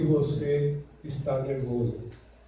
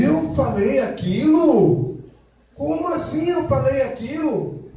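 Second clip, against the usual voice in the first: high, slow, offended question; indignant disbelief; 8 semitones higher, 6 dB louder, slower than usual. Acted. Spouse, accused after an apology, asks in slow offended disbelief. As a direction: act shocked that you said that.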